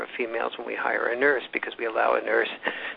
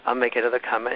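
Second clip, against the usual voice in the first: ordinary delivery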